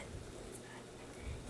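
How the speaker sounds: very soft, slow, unvoiced whisper; whispered echo. Real, not acted. Echoing to viewers how bad her crying was, whispered. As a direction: breathe it out like a sad afterthought.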